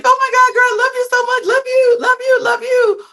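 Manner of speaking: very high, loud squeal of affection; hammed-up shrieky imitation of girls adoring each other; mock bestie gushing. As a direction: gush like overexcited best friends.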